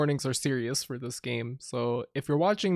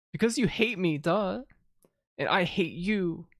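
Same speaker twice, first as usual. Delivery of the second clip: ordinary talk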